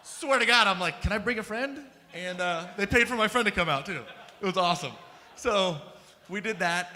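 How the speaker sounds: bubbling over with glee at the memory; excited. Real, not acted. Telling the audience about the free trip, loud and laughing. Gleefully retelling the paid trip.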